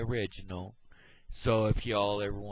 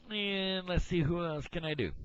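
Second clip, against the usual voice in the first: Kermit voice held; 13 semitones higher, 3 dB louder than usual. Retold or imitated. Still in Kermit voice.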